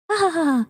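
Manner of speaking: a child's carefree giggle, lightly imitated. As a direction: play the kid laughing carelessly. Child laughing, voiced by mother.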